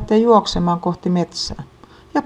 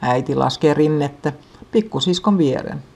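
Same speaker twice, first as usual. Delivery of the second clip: ordinary delivery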